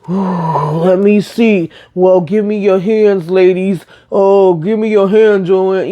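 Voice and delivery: slow, drawn-out hammed-up fortune teller voice; lightly put-on mystical medium, taking their hands; mock psychic. Friend acting as psychic reading hands.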